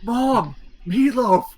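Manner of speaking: high, put-on grumpy teen; high-pitched sulky kid; whiny kid voice. Voicing Mark answering mom.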